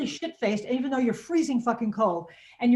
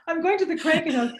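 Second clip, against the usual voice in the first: laughing demo; 8 semitones higher, 3 dB louder than usual. Shown as a demonstration. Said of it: "it sounds like Krankenhaus".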